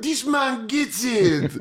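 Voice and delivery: high, slow approving voice; approving crowd. Retold or imitated. French crowd praising the comic, high slow approval. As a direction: drawl out a pleased verdict. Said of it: "They're like".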